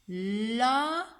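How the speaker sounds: "Lasso" with held l, a gap, long s; split syllables. Shown as a demonstration. Teacher dictates two syllables, "Lasso" split and stretched. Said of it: "deux syllabes".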